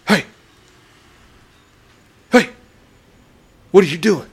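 high, loud mock yell at a stranger; retold yell. Neighbor yelling at a dad walking past her house. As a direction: shout it out from the porch. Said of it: "The neighbor's yelling at you".

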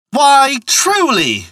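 high, drawn-out hearty exclamation; crying out. A narrator's reading. Man with the gloves answering a compliment, high slow cry. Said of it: "cried the man".

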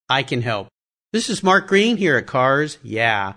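measured, polished self-promotional pitch; promo read. Real, not acted. Host offers brands his podcast audience, polished ad read. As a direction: pitch it deliberately, landing each phrase.

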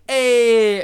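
imitating Dudley with a loud "ayy"; Dudley's "ayy". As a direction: call out a long cool-guy "ayy". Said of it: "Dudley's like".

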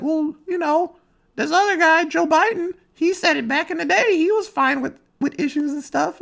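mock high-pitched arguer; squeaky mocking whataboutism; whiny online commenter. Mimics people online defending themselves.

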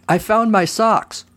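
high, slow triumphant shout; excited shout. A narrator's reading. Kevin shouting his news down the phone, high and slow. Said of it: "but then Kevin shouted".

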